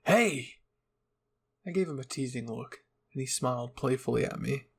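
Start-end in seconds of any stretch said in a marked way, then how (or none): 0.0-0.6 startled yelp. Acted. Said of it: "He let out a loud gasp".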